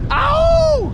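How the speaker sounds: sudden high whoop; excited yell. Real, not acted. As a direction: yelp it out high and loud. Sudden yell on hooking fish.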